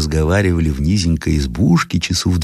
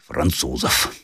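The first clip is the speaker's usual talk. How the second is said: ordinary delivery, nothing marked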